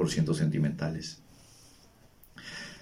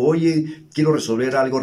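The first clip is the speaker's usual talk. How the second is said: mock confrontation; 6 semitones higher, 5 dB louder than usual. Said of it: "y le digo".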